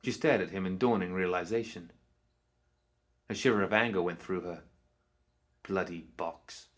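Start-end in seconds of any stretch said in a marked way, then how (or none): 5.6-6.7 slow, low bitter mutter; muttered under breath. Acted. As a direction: grumble it slowly to yourself. Pandora's bitter inner thought.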